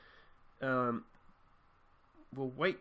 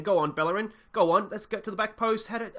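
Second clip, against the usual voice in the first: urging on; 8 semitones higher, 6 dB louder than usual. Real, not acted. Urging player on attack.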